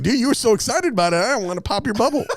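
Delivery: high fast laughing confession; laughing friend. Retold or imitated. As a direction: tease through laughter.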